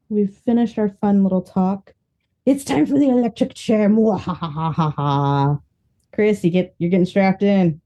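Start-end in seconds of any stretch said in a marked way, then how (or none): 2.4-5.6 mad scientist. Acted. Host's evil laugh segment.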